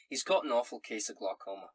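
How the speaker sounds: solemn Scottish letter-writer; Scottish accent. Acted. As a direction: break sad news in a Scots brogue. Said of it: "in a Scottish accent".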